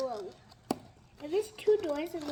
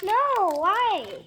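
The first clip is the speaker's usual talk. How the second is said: loud whiny no; loud protest; 3 semitones higher, 13 dB louder than usual. Real, not acted. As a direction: protest loudly.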